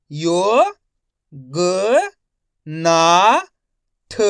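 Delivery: यो, ग, ना, थ held singly with pauses; syllable-by-syllable reading. Shown as a demonstration. Teacher modelling for learners, यो ग ना थ one syllable at a time. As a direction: sound out यो... ग... ना... थ separately. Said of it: "एक एक अक्षर का उच्चारण करके".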